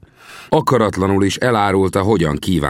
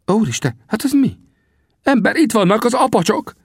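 very high-pitched frightened exclamation; alarmed, pitch shooting up; startled alarm. A narrator's reading. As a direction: cry out a sudden warning. Startled by sudden Apache attack.

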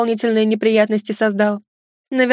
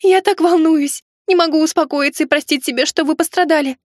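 high, hammed-up tearful fretting; sobbing; 6 semitones higher than usual. Heroine apologising to the injured guest, tearful and sobbing. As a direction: fret in a high, weepy voice. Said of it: "всхлипнула я".

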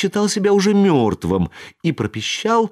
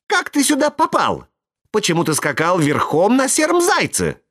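excited; 7 semitones higher than usual. Acted. Buratino eagerly questions Pierrot.

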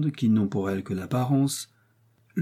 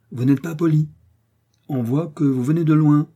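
raised, emphatic rebuke; cutting reproach; 5 semitones higher, 5 dB louder than usual. A narrator's reading. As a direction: put the rude youngster in his place. Athos insults D'Artagnan. (reproche appuyé, voix haussée)

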